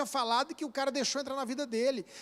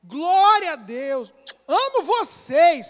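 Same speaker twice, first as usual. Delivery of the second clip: fervent greeting; 3 semitones higher, 7 dB louder, slower than usual. Real, not acted. Preacher greets congregation warmly.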